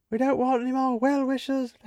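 flustered host turning away guests; Martin Freeman. Retold or imitated. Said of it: "I can totally see Martin Freeman doing this".

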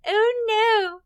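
high whiny fake sobbing; mock blubbering, hammed-up; fake crying. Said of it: "now he's like".

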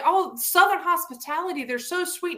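high-pitched gushing imitation; admiring outsider. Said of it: "most people say".

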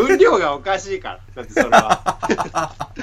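high, quick, laughing objection; amused, high-pitched insistence; laughing protest. Real, not acted. Laughing protest, later repeated soberly.

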